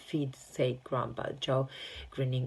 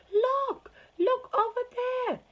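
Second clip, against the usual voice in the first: high excited scream; shrill girl's scream; 7 dB louder, slower than usual. A narrator's reading. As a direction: shriek it out, pointing across the river. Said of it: "she screamed".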